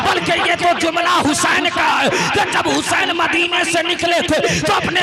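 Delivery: loud, high chant-like sermon shout; full-force preaching. Acted. Preacher voicing sky, earth and lovers claiming Hussain, full-force preaching. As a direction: build a pounding refrain for the crowd.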